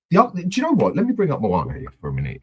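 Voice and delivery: ordinary talk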